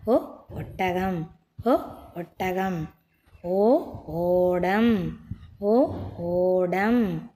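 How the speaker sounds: slow letter ஓ, a pause, then the word ஒட்டகம் or ஓடம்; slow alphabet drill on ஓ, ஒட்டகம், ஓடம். Shown as a demonstration. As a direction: say ஓ alone, then its word drawn out. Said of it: "சொல்லுங்க பாப்போம்".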